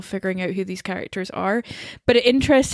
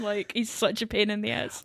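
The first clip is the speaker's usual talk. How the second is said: ordinary talk, nothing marked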